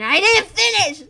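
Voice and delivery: loud cry of protest; indignant shout. Real, not acted. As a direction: shout that you were cut off. Interrupted, protests loudly.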